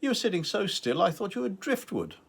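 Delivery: playful teasing question; Sunny's teasing voice. A narrator's reading. Narrator voicing Sunny teasing Edgar on the beach, light teasing. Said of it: "she had said".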